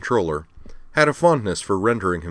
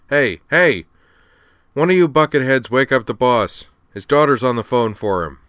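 loud, raised holler to the crew; calling out; 6 semitones higher, 6 dB louder than usual. Acted. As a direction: yell across the room to rouse someone. Al yells for crew to wake boss.

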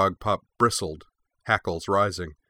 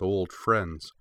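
usual speaking voice

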